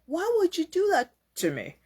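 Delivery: high-pitched, puzzled plea; mock-aggrieved question; wounded questioning. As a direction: act out a hurt "why me", pitched well up. Voicing the hurt, puzzled listener.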